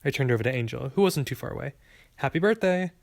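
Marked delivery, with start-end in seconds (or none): 2.2-2.9 high, lifted well-wishing; birthday greeting. A narrator's reading. Clerk turning to the birthday girl, cheerful greeting. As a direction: wish it brightly, pitch rising high.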